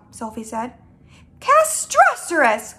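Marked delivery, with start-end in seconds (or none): 1.4-2.7 high, loud, drawn-out exclamation; slow, pitched-up outburst of dismay; horrified cry. Acted. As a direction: cry it out like a catastrophe. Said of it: "cried the B F G".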